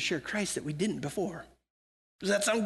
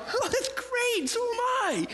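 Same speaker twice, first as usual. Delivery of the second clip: much higher, slow and delighted; much higher and slower, gleefully agreeing with the justice seeker; mock delighted; slower than usual. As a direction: pitch it way up, slowly, in playful glee. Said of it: "I go".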